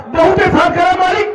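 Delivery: high, exclaimed praise of the master; effusive thanks. Acted.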